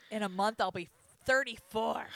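loud, playful protest; mock outrage. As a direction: blurt it out loudly in feigned offence. Playful protest about own age.

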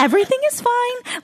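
high, sweet cabin announcement; flight attendant voice. Retold or imitated. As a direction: soothe the passengers, mock calm. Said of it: "like, flight attendant voice".

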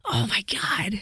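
a hushed oh my God, almost no voice; real whispered awe; awed whisper. Real, not acted. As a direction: breathe it out, overwhelmed. Awed aside mid-sentence.

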